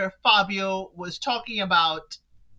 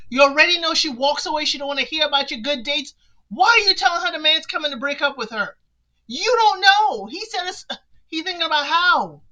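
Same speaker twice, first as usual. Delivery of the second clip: rising exasperated rant; 5 semitones higher than usual. Real, not acted. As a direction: get more and more worked up scolding her. Ranting at Shella on screen.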